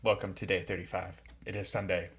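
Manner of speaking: low, soft, measured greeting; hushed, low-pitched show intro; odd put-on intro voice. Real, not acted. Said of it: "I don't know why I'm talking like that".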